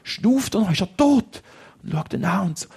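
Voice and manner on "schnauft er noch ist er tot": much higher, panicked asking; boy fearing his friend is dead; frightened questions. Acted. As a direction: voice rising in fear. Checking if friend breathes.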